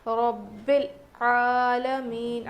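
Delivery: slow, drawn-out recitation voice; tajweed recitation. Shown as a demonstration. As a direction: recite it as a model for students. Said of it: "عین پر کھڑا زبر ہے". (تلاوت کے انداز میں آہستہ پڑھنا)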